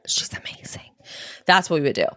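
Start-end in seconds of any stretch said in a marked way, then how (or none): 0.1-0.8 soft breathy whisper between two people. Retold or imitated. Mother and daughter at a show, whispering. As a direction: lean in and whisper it in awe. Mom and me after each song.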